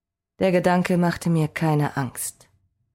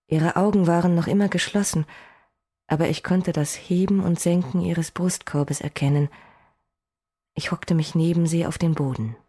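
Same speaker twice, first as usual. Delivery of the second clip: plain reading voice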